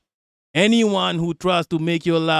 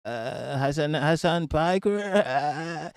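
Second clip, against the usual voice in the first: half-hearted mimicry with stretched, wobbling word endings; bad advisor impression; 5 dB quieter than usual. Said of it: "that's a bad impression".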